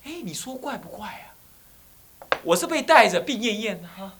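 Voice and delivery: ordinary talk, nothing marked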